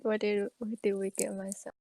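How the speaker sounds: usual speaking voice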